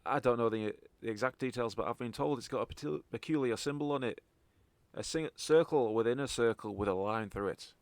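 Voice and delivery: halting Scottish informant; Scottish accent. Acted. Contact briefing the party on a book, Scottish accent. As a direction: pass on the job in a Scottish accent. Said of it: "properly in a Scottish accent".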